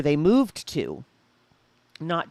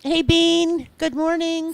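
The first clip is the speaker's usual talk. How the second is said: loud cheery greeting; 10 semitones higher, 7 dB louder, slower than usual. Real, not acted. Greeting viewers in chat.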